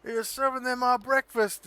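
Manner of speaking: high, loud complaining patron voice; angry customer. Acted. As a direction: yell it like an annoyed diner. Patrons yelling at waitress.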